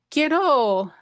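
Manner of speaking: ordinary delivery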